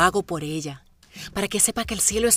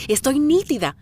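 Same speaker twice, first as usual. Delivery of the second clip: higher, louder, pumped up; excited; 4 semitones higher, 6 dB louder than usual. Real, not acted. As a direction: boast it with energy. Se anima con euforia. (eufórica)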